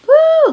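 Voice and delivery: loud high-pitched "woo"; shrieky cheer, way up high; excited whoop. Real, not acted. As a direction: let out a big celebratory whoop. Cheer before measuring belly.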